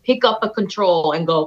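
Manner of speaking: ordinary talk, nothing marked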